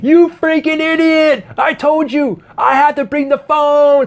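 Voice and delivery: high, slow furious yelling; angry yell. Retold or imitated. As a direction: scream it at the assistant. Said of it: "He's like".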